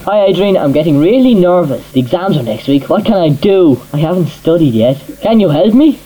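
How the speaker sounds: ordinary talk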